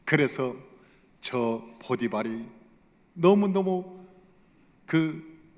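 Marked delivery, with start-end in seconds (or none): none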